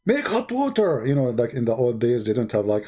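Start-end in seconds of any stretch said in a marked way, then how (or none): 0.1-1.0 mock scream. Husband ordering his wife about before his friend, mock scream. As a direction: imitate a bossy husband's shout, held back. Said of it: "He screamed. He said".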